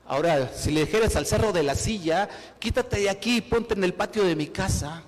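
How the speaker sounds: loud, driving preacher's voice; preaching at full force. Acted. Preacher urging the church not to say I can't, preaching loud. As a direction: hammer the point home to the congregation.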